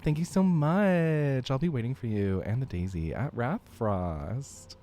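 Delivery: put-on bubbly Valley Girl accent, lots of "like"; Valley Girl accent, upbeat and chatty. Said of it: "the Valley Girl, um, accent or treatment".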